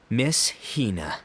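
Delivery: ordinary talk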